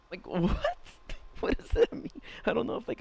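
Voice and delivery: high, breaking up with laughter; baffled laughter. Real, not acted. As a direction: laugh through your confusion. Baffled, cracking up at the text.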